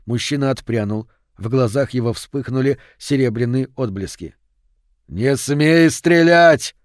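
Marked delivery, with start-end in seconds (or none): 5.1-6.8 loud, high, drawn-out yell. A narrator's reading. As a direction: cry out a desperate order, stretching the words. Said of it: "завопил он". (протяжный громкий вопль)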